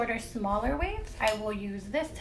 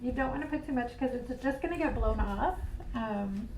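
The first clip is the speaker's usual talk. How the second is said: muffled; 7 dB quieter than usual. Real, not acted. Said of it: "I have my, um, mask on, so if it's hard to hear".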